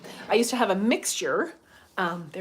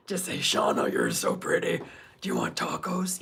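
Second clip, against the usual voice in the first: very low, husky imitation of his voice; man's deep voice; 4 semitones lower than usual. Swooning fan imagines Oscar asking her about tacos, deep imitated voice. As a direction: drop right down into his deep voice. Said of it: "His voice surprised me at first".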